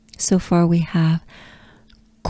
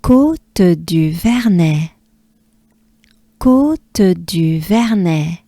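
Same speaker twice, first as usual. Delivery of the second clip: Côte du Vernet, slow and clear; careful teacher pace; slow model; slower than usual. Shown as a demonstration. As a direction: say it slowly. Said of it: "We can do this".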